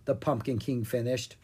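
ordinary delivery

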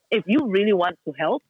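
ordinary delivery

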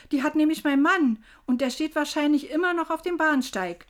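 loud, high, agitated explanation; herausplatzend. A narrator's reading. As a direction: burst out with the excuse, upset. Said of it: "platzte ich heraus". (laut und aufgeregt herausplatzen)

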